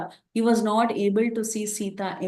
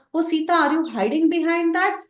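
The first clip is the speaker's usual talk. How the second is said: higher, hopeful peek behind the tree; hopeful searching call; 8 semitones higher, 4 dB louder than usual. Acted. Rama hoping Sita hides behind the tree, higher eager call. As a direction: voice a husband spotting a hiding place. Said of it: "he thought".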